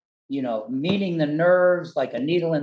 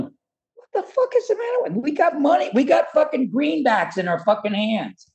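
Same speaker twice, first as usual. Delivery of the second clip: mock indignant squeal, much higher; retold protest; 12 semitones higher than usual. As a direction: squeak it out in outraged disbelief. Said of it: "And I'm going".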